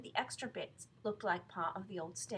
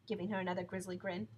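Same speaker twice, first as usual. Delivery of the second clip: usual speaking voice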